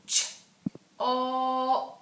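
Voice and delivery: Ch-op split and loud; sounded out slowly. Shown as a demonstration. Teacher segmenting chop for the class. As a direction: stretch Ch-op into its two sounds. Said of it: "say it slowly".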